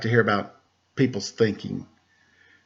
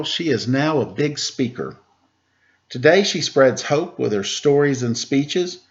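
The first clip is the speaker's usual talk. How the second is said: put-on reading to children. Teacher reading a Temple Grandin picture book to kids, lively storybook voice. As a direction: read the rhymes aloud to a class of kids.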